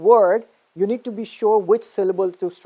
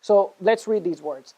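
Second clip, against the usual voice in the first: ordinary talk, nothing marked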